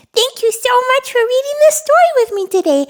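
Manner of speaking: high, fast, bubbly; fast excited host voice; excited outro. Acted. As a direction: cheer them on to subscribe. Upbeat channel outro.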